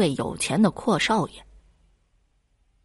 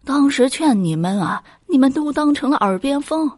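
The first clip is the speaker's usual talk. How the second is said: exasperated mother, rising higher; helpless reproach; 8 semitones higher, 4 dB louder than usual. A narrator's reading. Mother reproaches both daughters for ignoring advice, helplessly exasperated. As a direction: scold helplessly, pitch climbing as you go. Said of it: "无奈地说".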